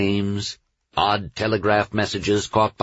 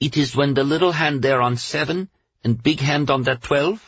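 put-on higher, curious young girl; a small girl asking carefully; child voice; 7 semitones higher than usual. Girl's question in story.

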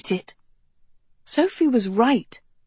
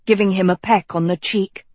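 usual speaking voice

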